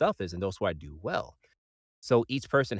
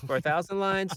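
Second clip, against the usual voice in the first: incredulous; 11 semitones higher than usual. Real, not acted. Incredulous at the request.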